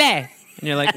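normal talking voice

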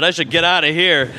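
ordinary talk, nothing marked

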